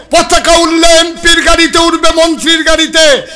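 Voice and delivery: loud, high-pitched sermon shout; sustained high, booming preacher's cry; full-force preaching. Real, not acted. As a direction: thunder the rebuke at the whole crowd.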